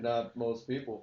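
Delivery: slow, measured production credit read; mock announcer. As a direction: announce it slowly like a show ident.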